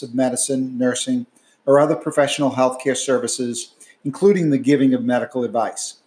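loud, steady disclaimer reading; fast legal disclaimer. Acted. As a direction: rattle off the legal fine print. Legal disclaimer read after outro.

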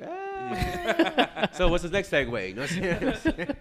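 high squeal breaking into laughter; laughing hard in a high voice. Real, not acted. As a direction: cackle through the words.

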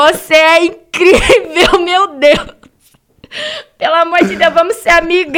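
high, loud, hammed-up adoration with laughter; gushing fan. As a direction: beg your idol like an overexcited fan. Reenacts gushing message to idol. (fã implorando, aos gritos e rindo)

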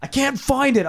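high-pitched mock distressed whine; mock panic. Host voicing roommate searching behind toilet, mock panic.

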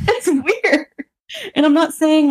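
high, giggly talk; laughing, pitched well up. Real, not acted. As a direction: talk through real giggles. Laughing over her own odd relationship.

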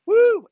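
loud, high whoop of joy; excited whoop. Real, not acted. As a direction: let out a loud cheer. Celebrating the listener's progress.

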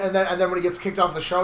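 usual speaking voice

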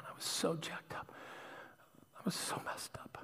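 barely voiced, under the breath; hushed confession. Real, not acted. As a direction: drop to a whisper as you admit it. Confessing, repeats it in a hush.

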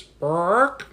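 high squawky chicken "Bark". Acted. Chicken squawking "Bark" at the librarian.

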